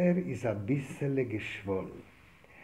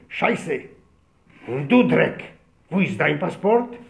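furious officer; 3 semitones higher, 7 dB louder, much slower than usual. Retold or imitated. Officer angrily reading passports.

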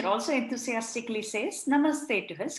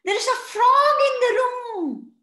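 high, loud excited squeal; squealing children; 14 semitones higher, 9 dB louder than usual. A narrator's reading. Children warn teacher about frog, squealing high and loud. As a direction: squeal it like thrilled kids. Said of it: "The children squealed".

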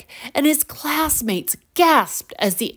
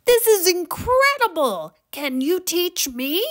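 put-on high, thrilled boy; high-pitched eager amazement; excited; 9 semitones higher than usual. A narrator's reading. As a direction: burst out high with delight, then plead eagerly. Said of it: "Max clapped in excitement".

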